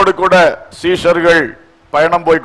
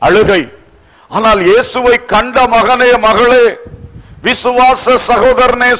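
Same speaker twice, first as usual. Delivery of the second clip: ringing, declamatory sermon delivery; forceful preaching; 4 semitones higher than usual. Real, not acted. Urging believers to look to Jesus, forceful preaching. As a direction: exhort the congregation in emphatic phrases.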